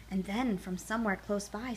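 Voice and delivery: ordinary delivery, nothing marked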